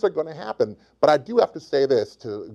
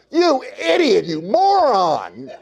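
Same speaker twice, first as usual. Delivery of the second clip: high, drawn-out comic insult; mock scolding; 5 semitones higher, slower than usual. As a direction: scold with hammed-up exasperation. Scolds imagined confessing boy.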